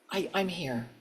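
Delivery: softly breathed "I'm here"; quiet affirmation. Acted. Signal to the divine on exhale.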